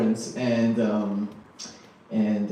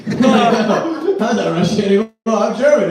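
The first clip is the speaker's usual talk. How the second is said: much higher and louder, slow and laughing; mock panic; 8 semitones higher, 9 dB louder, slower than usual. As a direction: hurriedly swear you were never Russian. Disowning Russia in mock panic.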